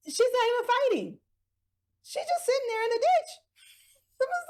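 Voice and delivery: a high squeak of amused disbelief; mock incredulity. Real, not acted. Mocking high voice.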